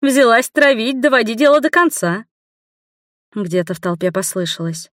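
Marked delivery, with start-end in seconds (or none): 0.0-2.2 taunting princess. A narrator's reading.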